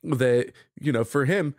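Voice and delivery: usual speaking voice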